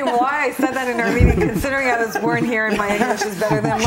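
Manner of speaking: quick, laughing self-mockery; fast and amused at herself. Real, not acted. Host explaining her Armenian slip to the guests, laughing.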